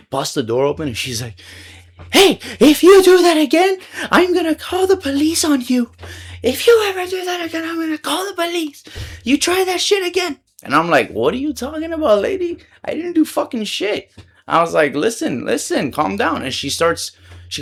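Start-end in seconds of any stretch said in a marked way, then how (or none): 2.1-10.4 high-pitched screeching threat; loud, hammed-up shrieking warning; imitated angry yelling. Woman bursts out threatening police. 11.1-14.1 high-pitched, incredulous comeback; acted-out indignation; retold indignant protest. Protesting the accusation. 14.9-16.4 high-pitched "calm down"; acted-out insistent pleading; retold urgent appeal. Telling angry woman to calm down.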